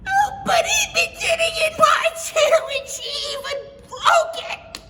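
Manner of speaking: very high, loud crying cub; wailing Baby Bear. Acted. Baby Bear crying over his empty bowl. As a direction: wail it through tears.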